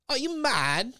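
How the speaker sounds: high-pitched English accent; shaky attempt at sounding English. Shown as a demonstration. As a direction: try out a put-on English accent. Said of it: "really good at an English accent".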